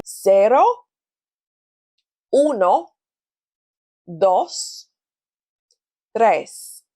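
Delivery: each number spoken clearly with a pause after it; counting for repetition. Shown as a demonstration. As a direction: count slowly, leaving room to repeat. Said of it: "So please repeat after me".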